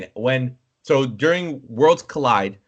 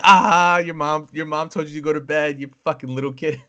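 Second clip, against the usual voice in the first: mocking taunt; 5 semitones higher than usual. Teens messaging the kid sent to bed, gleeful mockery. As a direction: make fun in a teasing, higher voice. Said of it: "we're making fun of a little kid".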